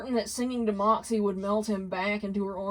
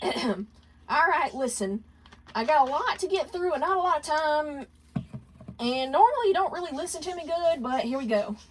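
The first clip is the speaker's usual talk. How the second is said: higher, brisk rambling lead-in; 7 semitones higher than usual. Acted. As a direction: rattle on to get it out. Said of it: "Millie began to ramble".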